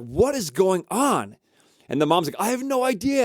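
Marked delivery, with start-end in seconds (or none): 0.0-1.4 high, drawn-out disbelief; baffled exclamation. Retold or imitated. Family chases runaway house, baffled. 2.4-3.2 high-pitched, helpless exclamation; acted bafflement. Mom answers baffled family.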